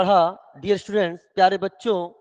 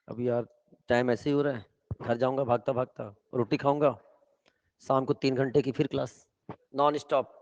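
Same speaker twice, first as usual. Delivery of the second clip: weary grumbling; 5 semitones lower, 6 dB quieter than usual. Real, not acted. Teacher sighs over packed schedule.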